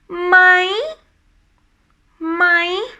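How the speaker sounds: "My" held high, lifting at the end. Shown as a demonstration. Teacher showing how it sounds, high tone "My". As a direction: keep climbing through "My". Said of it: "it sounds like you keep climbing".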